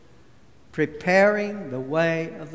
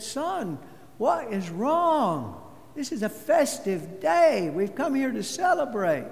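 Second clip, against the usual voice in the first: gentle mother; 6 semitones higher than usual. Acted. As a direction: coax the child gently. Mother asks crying son.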